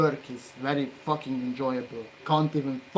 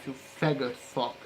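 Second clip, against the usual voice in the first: ordinary talk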